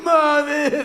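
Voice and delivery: high, loud fake sobbing; mock child's wail. Little actress turns tears on for mother, hammed-up bawling. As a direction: wail for mom like a little kid. Mimics girl's put-on crying.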